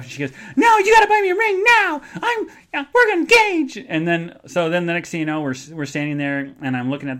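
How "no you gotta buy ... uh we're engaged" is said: very high, loud falsetto whine; whiny demand. Retold or imitated. Laura insisting he buy a ring, falsetto whine. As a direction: whine it like a spoiled fiancée.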